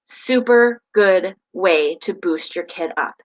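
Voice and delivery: normal talking voice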